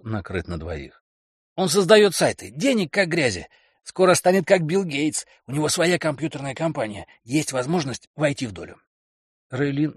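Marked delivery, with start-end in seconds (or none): 1.6-8.8 higher, excited bragging man; boastful boyfriend. Acted. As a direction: sell the big dream loudly. Kelly boasting about friend.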